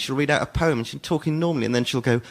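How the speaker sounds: normal talking voice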